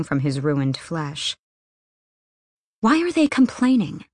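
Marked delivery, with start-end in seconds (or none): 2.8-4.0 sharp, higher angry question. Acted. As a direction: snap the question out in disgust. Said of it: "Nina asked angrily".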